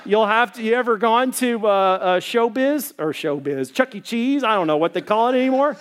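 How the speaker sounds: ordinary delivery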